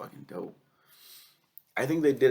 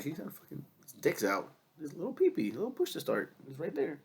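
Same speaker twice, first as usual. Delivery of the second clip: shocked; 6 semitones higher than usual. Retold or imitated. Retelling shock at manga.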